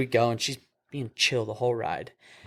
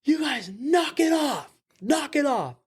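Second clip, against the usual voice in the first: high, slow imitated shout upward; partner yelling; slower than usual. Partner shouts up at the kids on the balcony, high slow mock yell. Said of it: "and he just goes".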